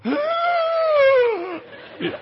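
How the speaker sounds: high, loud shocked gasp; all the air sucked out of her; huge gasp. Retold or imitated. Wife looking straight down, gasping sharply. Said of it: "you can hear her go".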